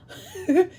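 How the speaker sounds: brief high-pitched giggle; short laugh. Real, not acted. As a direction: let a quick, high laugh slip out. Laughs at retold joke.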